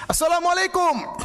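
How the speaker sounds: high, loud, drawn-out called greeting; loud salam shout. Retold or imitated. Preacher retells the policeman greeting an idol, shouting. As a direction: bellow the greeting at the statue to provoke. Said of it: "dia teriak keras".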